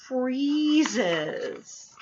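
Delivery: usual speaking voice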